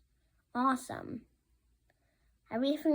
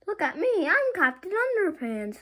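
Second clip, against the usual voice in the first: much higher and louder, proud hero; silly superhero voice; 9 semitones higher, 7 dB louder than usual. Acted. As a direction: boom it out like a goofy superhero.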